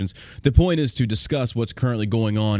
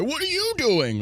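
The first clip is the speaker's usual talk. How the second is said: much higher, mock whiny question; mock whine; slower than usual. Mocking people who blame others, whiny high. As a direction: squeal it like a finger-pointer. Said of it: "pointing the finger and say".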